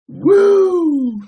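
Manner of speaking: high, loud wrestling woo; Flair woo. Retold or imitated. Hyping up chat with a loud high woo. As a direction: let out a big high woo.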